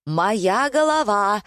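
mild narrated shriek. A narrator's reading. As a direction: screech the claim, stretching it. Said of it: "заверещала покупательница".

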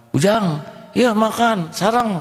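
put-on high, slow old man's offer; kind old man. Old man offering food to young students, high and slow. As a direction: invite the boy to eat like a kindly grandfather.

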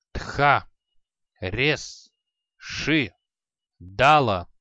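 chanted magic words, last one booming; spell incantation. Acted. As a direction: cast the spell word by word. Mage casting a spell.

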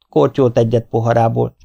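ordinary delivery, nothing marked

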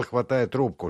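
ordinary delivery

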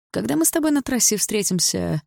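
condescending question to a rival; haughty. A narrator's reading. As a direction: ask it loftily, looking down on a rival. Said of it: "спросил Лайм надменно".

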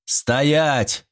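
commanding, powerful shout; thunderous command. A narrator's reading. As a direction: stop them with authority. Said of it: "Его голос, полный силы и власти, прозвучал как гром".

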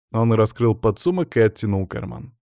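ordinary delivery

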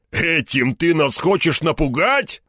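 lightly voiced thug, drawing out the challenge; scoffing bearded thug. A narrator's reading. Bearded thug challenging the hero, slow mocking exclamation. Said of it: "воскликнул один из них с косматой бородой".

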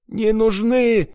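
high, slow howling wail; howling plea. A narrator's reading. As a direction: wail out the refusal. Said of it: "выл апостол". (воющий, протяжно)

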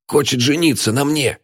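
put-on high, agitated old man's outburst; blurting out. A narrator's reading. Old man tells friend of marriage plan, blurting. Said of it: "выпалил старикан".

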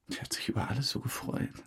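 whispered with regret; hushed, sorrowful whisper; sad whisper. Real, not acted. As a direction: breathe it out sadly. Regret over Ines.

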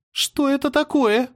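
almost scream it in fear; frightened near-shout. A narrator's reading. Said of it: "почти выкрикнула Аня".

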